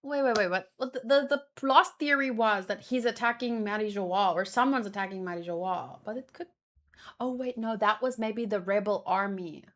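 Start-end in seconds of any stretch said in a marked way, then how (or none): none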